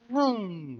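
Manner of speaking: high vroom of a passing car; rising engine vroom; zooming past sound. Shown as a demonstration. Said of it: "like a high-pitched, like a".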